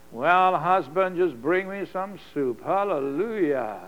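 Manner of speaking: milk it like a helpless patient; mock feeble invalid. Sick wife on the couch asking husband for soup, slow mock-feeble whine.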